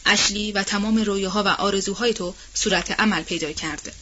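plain reading voice

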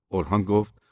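ordinary delivery, nothing marked

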